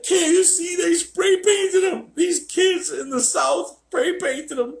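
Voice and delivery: very high, loud, slow mock whine; high slow falsetto wail about his dog; crying owner voice. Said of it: "And he's like".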